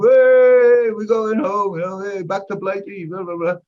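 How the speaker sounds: high, loud "hey" easing back into chatter; mock crowd cheer. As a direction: imitate merry passengers cheering for home. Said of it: "everybody's going".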